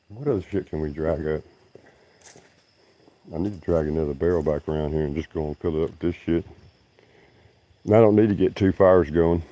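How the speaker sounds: ordinary talk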